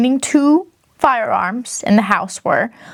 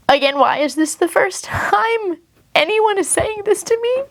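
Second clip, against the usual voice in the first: pitched up high, incredulous complaint; why is this the first time, outraged; exasperated disbelief; 10 semitones higher than usual. Real, not acted. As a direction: vent the frustration, voice climbing. Exasperated complaint about the mediation.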